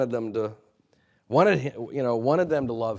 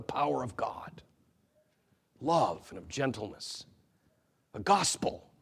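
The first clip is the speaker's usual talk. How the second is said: whispered, hushed offer of love; gentle whisper; 6 dB quieter than usual. Retold or imitated. Paul offers the church gentleness, whispered. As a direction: breathe it out softly, tenderly. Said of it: "that last question".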